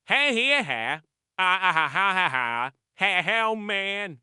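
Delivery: hey-hey chuckle into a wheezy giggle; wheezing cartoon laugh. Podcast host laughs at co-host's posh jab, wheezing giggle. Peter laughs at Stewie's joke.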